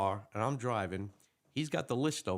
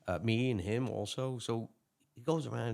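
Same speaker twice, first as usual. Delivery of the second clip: ordinary talk, nothing marked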